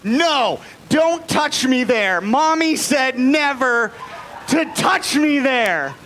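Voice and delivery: high, slow, drawn-out mock yell; imitated child's yell. Young son yelling at dad in the bathroom stall, mock yell. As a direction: yell it out like an upset little boy. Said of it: "he yells out".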